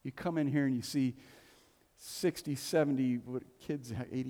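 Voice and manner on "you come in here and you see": ordinary talk, nothing marked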